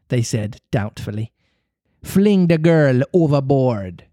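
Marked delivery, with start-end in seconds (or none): 2.0-4.1 a touch of a slow captain's order; mild cry. A narrator's reading. Hook ordering the crew against the cloaked figure, mild slow cry. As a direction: order it slowly, mildly raised. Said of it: "cried Hook".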